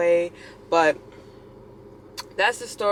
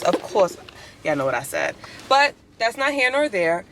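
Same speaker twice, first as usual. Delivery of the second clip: normal talking voice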